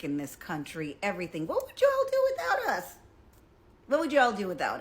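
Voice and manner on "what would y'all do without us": much higher, squealed challenge; incredulous challenge. Real, not acted. Challenging her audience, high incredulous question. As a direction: fling the question up high in disbelief.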